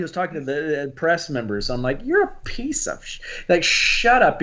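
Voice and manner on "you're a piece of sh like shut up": mock angry retort. Imagined retort to the president.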